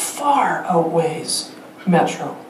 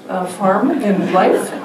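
usual speaking voice

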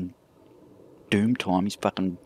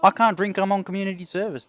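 much higher, sing-song mimicry; mocking mimic; 10 semitones higher than usual. Teasing the paramedic by repeating his excuse, mocking higher mimic. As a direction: parrot his excuse back to tease. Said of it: "mocking the paramedic's excuse".

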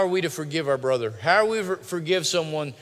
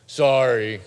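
grudging, forced sorry, louder than usual; insincere apology; 8 dB louder than usual. Acted. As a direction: apologize without meaning it, like a scolded kid. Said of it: "say it like you mean it".

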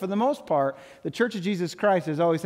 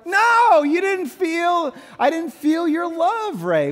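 much higher and louder, a mock-hurt wife; hurt wife's reply; 10 semitones higher, 6 dB louder, slower than usual. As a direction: squeal it back as the wounded wife.

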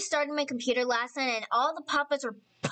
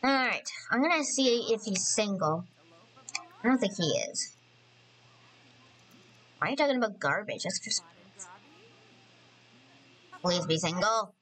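usual speaking voice

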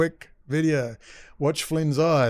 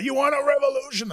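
mocking Schroyer; 12 semitones higher than usual. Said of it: "good old Owen Schroyer".

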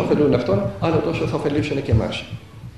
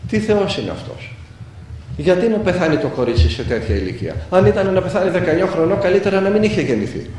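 acting out a grieving man's angry questioning; retold anguished outcry; 3 semitones higher than usual. Grieving uncle rails at God over his dead niece, retold anguished outcry. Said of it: "φώναζε και έκλαιγε αυτός".

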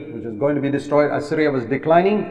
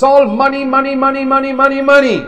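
much higher and louder chant of money; mocking repetition; 10 semitones higher, 5 dB louder than usual. Real, not acted. Preacher scorning greedy leaders, high and loud. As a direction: hammer money again and again.

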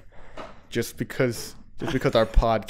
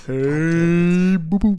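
loud, higher Yogi Bear call; Yogi Bear impression; 5 semitones higher, 5 dB louder, much slower than usual. Answering the Yogi Bear request, calling to Boo Boo. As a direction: hail Boo Boo like a cartoon bear. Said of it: "Do Yogi Bear".

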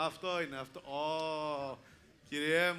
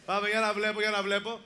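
calling out; 3 semitones higher, 6 dB louder than usual. Real, not acted. Host rallies crowd to shout.

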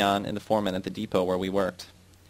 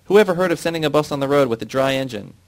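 angry shout; 5 semitones higher, 5 dB louder than usual. Acted. Foreman keeps shouting.

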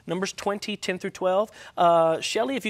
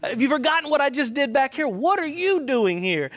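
raised, higher-pitched challenge; insistent preacher's voice of a challenging God; calling out, confronting; 5 semitones higher, 4 dB louder than usual. Acted. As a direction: confront a runaway who has forgotten you. Acts God confronting the runaway Elijah.

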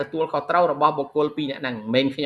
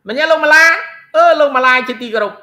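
raised, higher voice calling on students; 7 semitones higher, 6 dB louder than usual. Real, not acted. Teacher calls on Mala and Lihuy, calling out. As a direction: call out the names loud and high.

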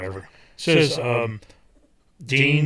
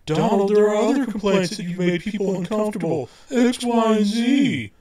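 mock editor; 8 semitones higher than usual. Editor voiced in retold meeting.

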